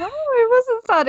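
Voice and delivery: high, loud laughing denial; amused protest. Real, not acted. Protesting tease.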